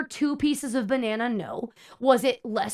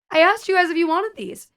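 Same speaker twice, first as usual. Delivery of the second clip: raised, pointed snap at the customers; exasperated; 7 semitones higher, 6 dB louder than usual. Retold or imitated. Server scolding customers for refusing condiments, exasperated. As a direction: let them know you're fed up.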